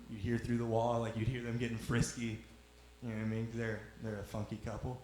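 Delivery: usual speaking voice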